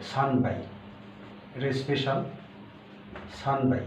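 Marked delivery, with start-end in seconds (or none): 0.1-0.6 "সান বাই" with h turned to b; changed pronunciation. Shown as a demonstration. Japanese teacher shows learners the change for three glasses, "সান বাই" with b. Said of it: "ব হয়ে যাবে".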